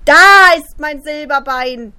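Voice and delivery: high, loud, slow ghostly cry; jump-scare the listener with it; sudden scare shout. Acted.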